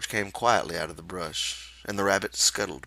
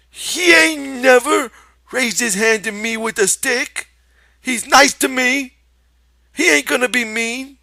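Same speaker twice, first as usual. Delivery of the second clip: put-on high, loud childlike insisting; cling to faith in George, loudly; Lenny insisting; 15 dB louder than usual.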